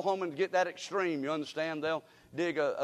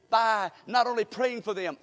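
ordinary talk, nothing marked

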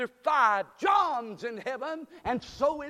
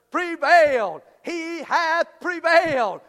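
loud, raised preacher's cry; proclamation très forte; 4 semitones higher, 8 dB louder, slower than usual. Real, not acted. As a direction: belt it out in triumph from the pulpit. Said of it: "Am I too loud?".

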